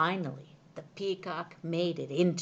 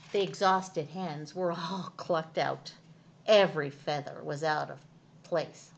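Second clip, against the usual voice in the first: usual speaking voice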